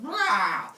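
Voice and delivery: loud imitated monster roar; Python. Giant snake lands on the ground, loud roar.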